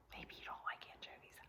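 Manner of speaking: soft whispered aside. Real, not acted. Teacher whispering an aside to the class. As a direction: let them in on a secret. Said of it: "whispered aside to class".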